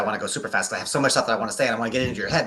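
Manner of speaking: fast, tumbling rush of words; hurried excited gabble; rushed excited talk. Shown as a demonstration. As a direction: race through it like you can't wait to say it all. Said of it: "I talk fast when I get excited".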